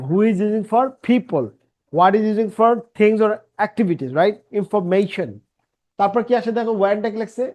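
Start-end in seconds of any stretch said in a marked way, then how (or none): none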